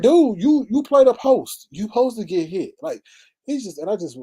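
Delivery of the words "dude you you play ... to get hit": loud, high exasperated comeback; heated retort, easing toward the end; going off on him. Retold or imitated. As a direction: re-enact telling off a whiner. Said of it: "I went off on him".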